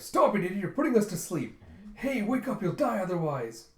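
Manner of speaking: high, loud urgent protest; alarmed pirate yelling. Retold or imitated. Pirates fighting off the lullaby, alarmed yelling. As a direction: yell to jolt a sleeper awake.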